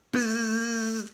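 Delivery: nasal-free flat buzz imitating a quiz buzzer. A narrator's reading. Reader imitates the quiz buzzer, steady buzz. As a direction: make the buzzer noise with your lips.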